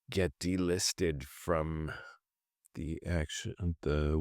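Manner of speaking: usual speaking voice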